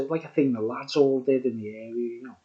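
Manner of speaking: ordinary talk, nothing marked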